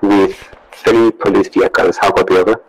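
loud radio transmission; crackly military radio. Real, not acted. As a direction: voice over a field radio. Radio call from another unit.

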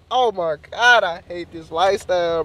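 normal talking voice

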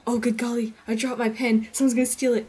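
loud, fretful nerd voice; panicked nerd. Retold or imitated. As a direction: fret aloud over the pen. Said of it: "doing stuff like this".

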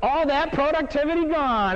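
slow, high, exaggerated dismay; mock lament. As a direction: mourn it theatrically for the crowd. Mock lament over promotion to management.

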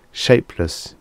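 usual speaking voice

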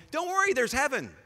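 high, breezy brush-off; dismissive God. Pretend. Preacher mocking an uncaring God, high and breezy. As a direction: wave the pain away glibly. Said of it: "God has no compassion".

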